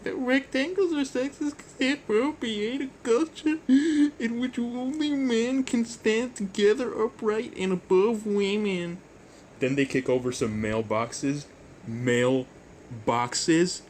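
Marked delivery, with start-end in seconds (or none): 0.0-9.0 very high, louder mock falsetto woman, slowing down; squeaky hammed-up falsetto lecture; high mocking lady voice rising into a shrill rant. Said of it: "That, that is an amazing woman voice".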